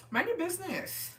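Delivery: high, sing-song mind your business; mock scolding. Teen to snooping classmate, high mocking scold.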